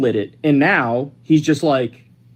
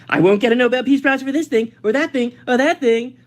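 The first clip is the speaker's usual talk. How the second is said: higher-pitched, sing-song mock whining; mock desperate complaint; 7 semitones higher than usual. Host imitates the president wanting a Nobel prize, mock desperate. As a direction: list the grievances like a needy complainer. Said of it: "desperately wanting a Nobel Peace Prize".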